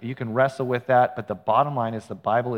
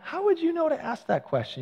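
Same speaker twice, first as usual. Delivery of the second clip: amazed question; 3 dB quieter than usual. Retold or imitated. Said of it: "I was kinda like".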